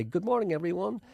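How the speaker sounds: high, bright-voiced good morning; chirpy announcer hello; broadcast greeting. Presenter greeting New York viewers, high breezy on-air voice. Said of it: "when we say".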